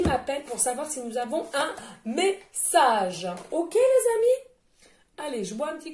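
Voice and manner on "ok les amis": drawn-out high call. Real, not acted.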